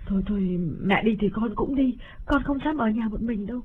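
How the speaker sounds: put-on frightened girl. A narrator's reading. Cúc begs her mother, scared to stay alone. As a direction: plead not to be left alone. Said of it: "lắc đầu nói".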